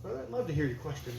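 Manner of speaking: quick, soft courteous reply; a hint of a smarmy preacher; polite missionary. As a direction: sweet-talk the questioner. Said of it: "He said".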